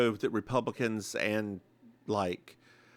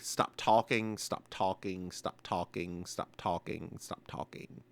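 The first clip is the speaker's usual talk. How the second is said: fading into a low near-whisper; choked-up plea, trailing away to almost nothing; verklempt muttering; 7 semitones lower, 9 dB quieter than usual. Retold or imitated. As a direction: repeat it under your breath, sinking lower and quieter. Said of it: "getting a little verklempt".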